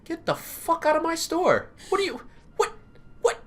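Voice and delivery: high-pitched furious shopkeeper; angry shopkeeper. Acted. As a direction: screech at the thief.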